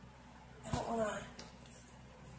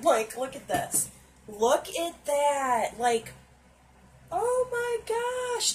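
louder, slow and drawn out with awe; thrilled gushing; 5 semitones higher, 5 dB louder, slower than usual. Real, not acted. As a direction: gush over the colors at full volume. Thrilled by the palette.

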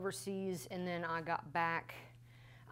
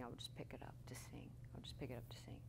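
whispered; 5 semitones lower, 13 dB quieter, much faster than usual. Real, not acted. Intimate reflection on singing.

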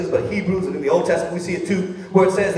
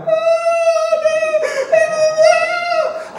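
put-on very high, loud toddler wail; mock a little girl crying in the playpen; imitating a crying toddler; 5 dB louder, slower than usual. Father imitates his crying baby girl, high wail. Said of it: "she was just crying".

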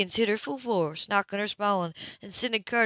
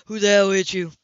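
angry demand; 8 dB louder than usual. A narrator's reading. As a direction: bark it at the room. Man demands who hurt her.

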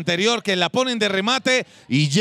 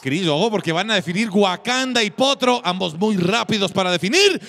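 usual speaking voice

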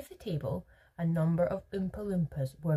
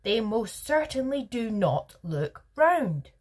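slow, loud, higher-pitched denial; emphatic contradiction; 5 semitones higher, 7 dB louder, slower than usual. A narrator's reading. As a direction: deny it forcefully, word by word. Veruca flatly contradicts Wonka.

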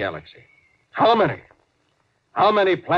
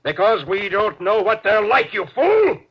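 rising to a high, loud snap on fool; captain scolding his officer; angry rebuke; 7 semitones higher, 4 dB louder than usual. Acted. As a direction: lose patience with him.

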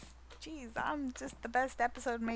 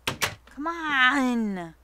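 ordinary talk, nothing marked

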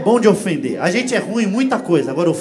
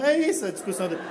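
ordinary talk, nothing marked

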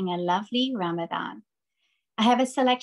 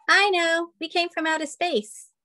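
giraffe voice; 8 semitones higher than usual. Acted. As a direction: blurt it out like an eager giraffe. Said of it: "the giraffe says".

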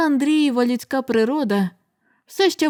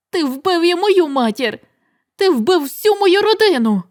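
higher, louder accusing cry; shouting back; 6 semitones higher, 4 dB louder than usual. Acted. Son accusing his father, shouting. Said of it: "крикнув йому у відповідь Андрій".

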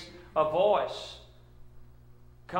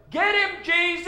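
very high, loud squawking yell; parrot yell; 11 dB louder, slower than usual. Acted. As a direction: screech it out like a parrot. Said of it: "that parrot yells".

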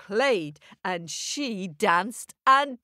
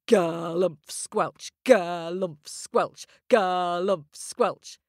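stomping footsteps; slower than usual. Acted. Giant's heavy footsteps sound.

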